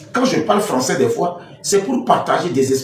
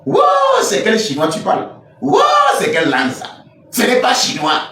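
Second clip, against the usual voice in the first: mock outrage; 8 semitones higher than usual. Critic hearing his fake Chinese, exaggerated high-pitched disbelief.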